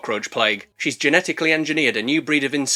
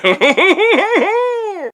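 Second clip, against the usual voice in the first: high, put-on hooting laugh; string of high hoots of acted glee; mock gleeful laugh; 5 dB louder than usual. Shadowy figure laughing at pinned bug, high mock laugh.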